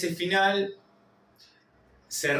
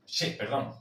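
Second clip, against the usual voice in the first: ordinary talk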